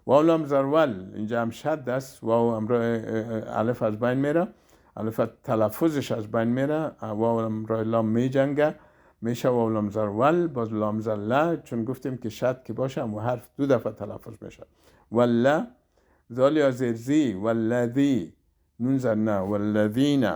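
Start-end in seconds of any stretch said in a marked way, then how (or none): none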